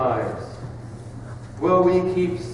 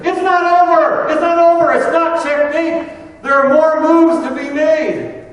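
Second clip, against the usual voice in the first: excited shout, pitched way up and much louder; 13 semitones higher, 10 dB louder than usual. Retold or imitated. Preacher voicing a chess master who spots a way out, very excited. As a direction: burst out, crying it high and loud. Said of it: "he got very excited".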